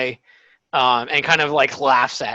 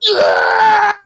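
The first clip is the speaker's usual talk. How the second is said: very high, loud shriek; frenzied kid's war scream; wild yell; 7 dB louder than usual. Acted. Game master imitating kids tearing bread, shrieked yell. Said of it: "and, like, yelling".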